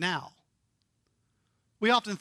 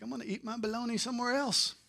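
mock listener reply; 3 semitones higher, 7 dB quieter, much faster than usual. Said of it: "You'd be like".

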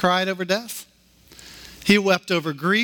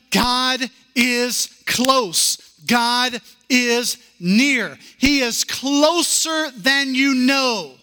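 high, slow, swelling sermon climax; soaring preaching; 7 semitones higher, 3 dB louder, much slower than usual. Acted. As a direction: lift it up and slow right down.

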